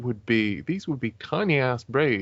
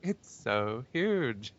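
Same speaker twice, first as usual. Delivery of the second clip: put-on Valley Girl accent; 5 semitones higher than usual. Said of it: "my Valley Girl accent".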